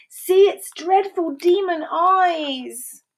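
high, slow, dramatic cry; drawn-out high-pitched alarm. A narrator's reading. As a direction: wail it out in storybook terror. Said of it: "cried the Deinonychus".

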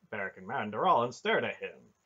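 plain reading voice

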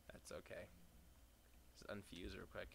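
breathy whispered aside; soft whispered shrug. Real, not acted. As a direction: whisper it under your breath. Muttering to himself.

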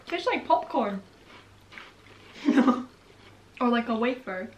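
slightly muffled by hand; talking between bites of candy; speaking with fingers at lips. Real, not acted. Seen 1: right speaker's fingers rest on lips while talking, after eating candy.

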